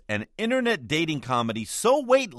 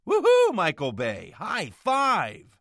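mock cheer; 6 semitones higher, slower than usual.

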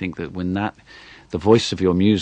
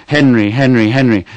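louder, urgent calls of Henry, Henry; grandma shouting; 5 semitones higher, 12 dB louder than usual. Acted. Grandma clutching the boy's arm, calling his name in a loud, urgent voice. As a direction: call the boy's name over and over, pleading. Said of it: "she was shouting really loudly now".

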